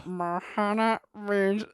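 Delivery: slow, stiff robotic Stitch attempt; robot-like, badly faked Stitch. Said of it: "You sound like a robot".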